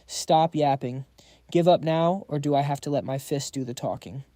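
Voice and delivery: plain reading voice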